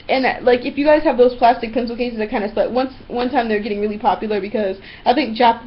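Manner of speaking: usual speaking voice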